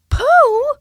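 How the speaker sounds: high, very loud calling shout; a boy shouting for his friend. Acted. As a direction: call across the wood to a friend. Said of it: "shouted Christopher Robin".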